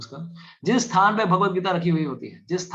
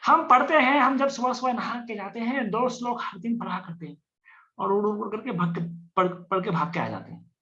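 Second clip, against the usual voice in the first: much higher, boastful mock voice; mock hurried reader; 7 semitones higher than usual. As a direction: parody a devotee who rushes through. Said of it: "पूजा घर में पढ़िए भी". (ऊँची आवाज़ में जल्दबाज़ भक्त की नकल)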